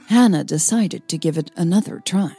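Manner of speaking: plain reading voice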